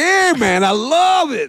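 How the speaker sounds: high, drawling Texan; mock Texan cowboy drawl; Texas accent. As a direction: lay on a Southern Texas twang. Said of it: "the, the Texas".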